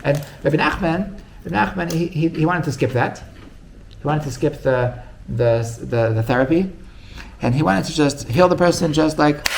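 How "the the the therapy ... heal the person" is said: ordinary delivery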